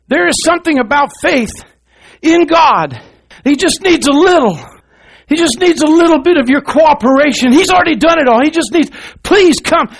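loud, high revival preaching, near shouting; preaching at full force. Acted. Preacher rousing his church to faith, shouting it out. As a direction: drive it home to the congregation.

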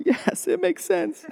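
high, slow falsetto aside; a silly high voice, drawn out; squeaky mock agreement; mock realization. Adult empathizing mock voice.

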